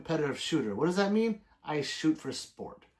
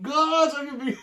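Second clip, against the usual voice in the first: laughing fan voice; 11 semitones higher, 6 dB louder, faster than usual. Retold or imitated.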